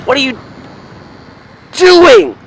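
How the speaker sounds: rising to a high loud cry of alarm; real shock at another driver; shocked outburst. Real, not acted. Near accident while driving.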